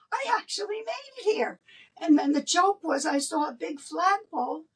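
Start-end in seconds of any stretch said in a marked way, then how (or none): 0.1-1.6 high, fast, amazed little cry; amazed relief. Retold or imitated. Woman reaching Daytona, high amazed relief. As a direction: marvel at arriving safely. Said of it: "I'm like".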